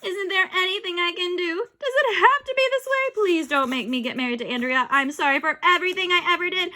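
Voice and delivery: high, loud panicked begging; desperate pleading. Acted. Boy begging not to marry Andrea, high. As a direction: beg for mercy.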